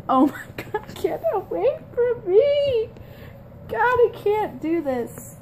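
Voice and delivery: high squealing laugh; being tickled. Real, not acted. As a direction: laugh out words while tickled.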